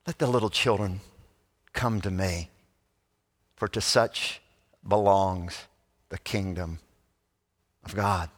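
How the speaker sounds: low, soft, with long pauses; tender welcome. Acted. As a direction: gently welcome children, phrase by phrase. Jesus tenderly welcoming children.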